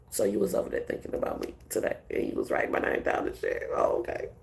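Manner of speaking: teasing hushed whisper to a hater. Pretend. Whispering to someone who was writing her name down. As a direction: lean in and whisper it like a secret you caught.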